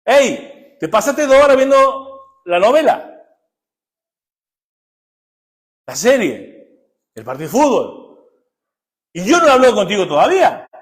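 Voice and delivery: God trying to get your attention; God calling out. Retold or imitated. God telling a distracted adult to put the phone down, loud call. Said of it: "que Dios le dice".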